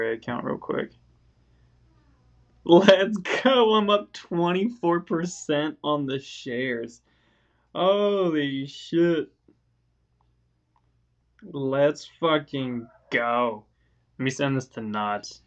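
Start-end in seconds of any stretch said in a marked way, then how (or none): 2.6-3.7 high, loud victory yell; triumphant shout. Real, not acted. Sees IRA up big. 3.7-13.6 stunned elation. Real, not acted. Stunned by account gains.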